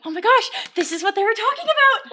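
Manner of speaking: gasping, high, loud retold amazement; amazed realization. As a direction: relive the sudden revelation with a laugh. Acts out her amazed realization.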